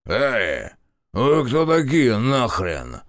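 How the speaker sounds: пьяный рёв, медленно и громко; взреветь спьяну на незваных гостей; belligerent drunk. A narrator's reading. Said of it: "пьяно взревел один из баронов". (пьяный рёв)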